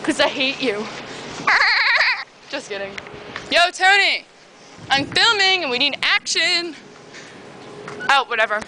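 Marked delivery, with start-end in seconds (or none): 0.9-2.2 a high, crying-out giggle; high squealing laugh. Real, not acted. Teasing mom then laughing it off with a high squeal. Laughs after "I hate you. 3.5-6.8 calling out. Real, not acted. Calling out to Tony.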